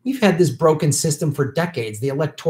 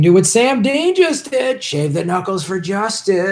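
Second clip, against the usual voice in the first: hammed-up, higher-pitched silly voice; bad put-on accent; 5 semitones higher than usual. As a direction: call it out high in a badly faked accent. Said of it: "I have the worst accents ever".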